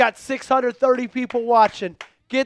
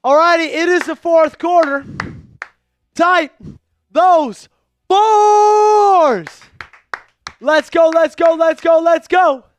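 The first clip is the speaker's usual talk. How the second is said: higher and louder, shouting let's go; loud hype; 7 semitones higher, 8 dB louder, slower than usual. Real, not acted. Host fires up chat for fourth quarter, loud excited shouting. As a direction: pump up the stream for the fourth quarter.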